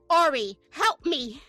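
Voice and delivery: loud, drawn-out playful plea; mock plea for help. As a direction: beg a colleague for rescue, hammed up. Acts out asking colleague for help.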